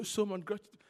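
ordinary delivery

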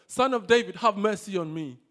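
loud cry, pushed hard on the first words; insistent, full-voiced plea. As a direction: shout over those silencing you. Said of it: "he shouted all the more".

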